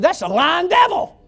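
high, loud rebuke; commanding shout. Retold or imitated. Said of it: "this is what the Lord said".

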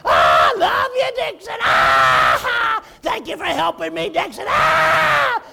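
very high loud cackling laughter through the words; hammed-up drunk laughter; drunken laughing. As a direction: laugh wildly between the words. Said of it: "lay there laughing".

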